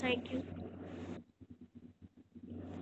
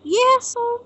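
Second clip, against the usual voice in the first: excited exclaim; 7 semitones higher, 11 dB louder than usual. Real, not acted. Excited at the sight.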